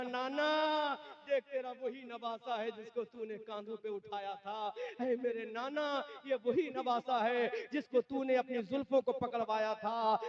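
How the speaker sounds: high-pitched mournful appeal; acted tearful plea. Zainul Abideen showing the head to his grandfather, tearful plea. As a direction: plead in grief, voice lifted high. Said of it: "रो रो के कहा".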